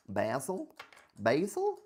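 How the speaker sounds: rising high loud Basil; mock-puzzled high Basil; playful questioning. Real, not acted. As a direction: squeak the word up playfully.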